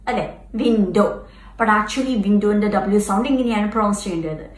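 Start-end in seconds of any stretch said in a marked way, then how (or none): 0.6-1.1 V-like start to വിൻഡോ; teeth on lip W. Shown as a demonstration. Teacher repeating the wrong V-sound വിൻഡോ. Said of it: "It is like touching my teeth".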